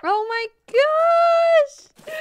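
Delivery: long, high, loud drawn-out shriek of delight; excited scream. Real, not acted. Streamer seeing her birthday house, screaming with delight. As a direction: squeal it out slowly in amazement.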